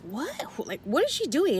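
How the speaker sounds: a soft then high confused question; puzzled, half under her breath; baffled. Retold or imitated. Couple stares at a ranting stranger, baffled.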